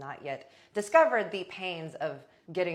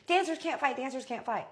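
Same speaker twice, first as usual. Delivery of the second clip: high, urgent imitation of a bouncer; mock bouncer breaking up a fight; panicked bouncer; 8 semitones higher than usual. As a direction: call out a repeated warning, pitched up.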